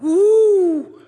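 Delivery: high, loud mock whoop of a failing engine; imitated transmission whine. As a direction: throw out a sudden high whoop with a laugh. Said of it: "it went".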